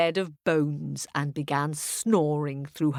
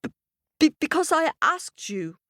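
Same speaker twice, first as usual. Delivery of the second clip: high, stuttering, frightened voice; stammering girl; 11 semitones higher, 3 dB louder than usual. A narrator's reading. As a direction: stammer it nervously.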